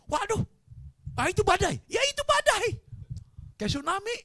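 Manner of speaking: high, loud panicked yelling; acted storm panic, voice shooting up; panicked alarm. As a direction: shout that disaster is coming.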